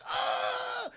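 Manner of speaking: very high stretched-out "ah"; mock pained scream. Chucky stabbed by Tyler, mock pained scream. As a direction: imitate a stabbed doll's shriek.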